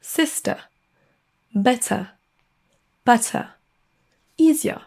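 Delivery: British word list, final R dropped to a schwa; British accent. Shown as a demonstration. Teacher giving learners examples, British accent without final R. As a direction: model each word in British English, non-rhotic. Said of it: "dans l'accent britannique".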